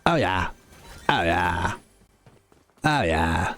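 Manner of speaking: low, drawn-out movie-character drone; Office Space guy impression. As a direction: sink low and stretch it out. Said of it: "Like the guy from The Office".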